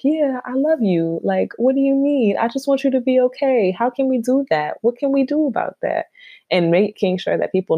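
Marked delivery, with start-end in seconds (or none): 0.0-6.1 tender caring voice. Retold or imitated. To a guest in her candlelit room, tender higher voice. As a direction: soothe them like a sister with tea. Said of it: "we just talking like".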